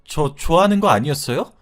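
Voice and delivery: high, wounded outcry; acted plea of a spurned woman; crying out. A narrator's reading. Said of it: "수영이의 외침에".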